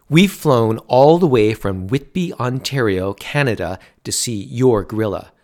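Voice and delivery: loud, rising complaint, building in force; angry rant. A narrator's reading. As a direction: demand it, fed up and furious. Dad's angry rant at owner.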